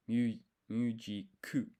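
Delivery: "mu ji ku" said slowly, leaning on a doubled K before ku; slow down and hit the K in "mu ji ku" hard; double K emphasis. Shown as a demonstration. Teaching the small tsu to viewers: slow, stressed double K. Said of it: "really putting that emphasis on this K sound".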